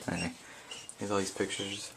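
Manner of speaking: ordinary talk, nothing marked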